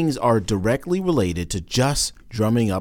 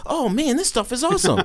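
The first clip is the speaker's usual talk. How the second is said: higher, gushing fake delight; mock gushing; 9 semitones higher than usual. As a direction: gush over the free swag like a spoiled celeb. Mocking swag-bag celebrity gushing.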